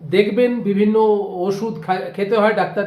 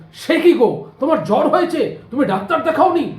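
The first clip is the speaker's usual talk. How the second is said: fussy alarm; 6 semitones higher than usual. Retold or imitated. Nosy neighbour alarmed.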